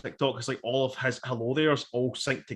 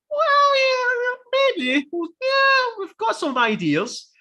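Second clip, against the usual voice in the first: very high put-on voice, hedging; coy mock voice; 4 dB louder, much slower than usual. Film makers hinting at series two, in a high coy mock voice. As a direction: squeal it coyly, then ease down slightly.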